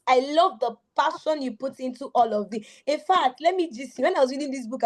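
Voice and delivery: ordinary talk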